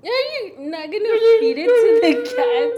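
loud laughing insistence; laugh-cry through it. Real, not acted. Spicy food, laughing insist.

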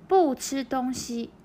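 就算在減肥 drawn out syllable by syllable; slow model reading. Shown as a demonstration. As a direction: read it slowly for learners to copy. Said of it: "なので". (ゆっくり模範読み)

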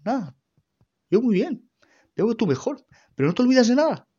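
mock the cross mother snapping at her son; angry mother. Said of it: "me mira, toda cabreada".

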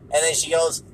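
normal talking voice

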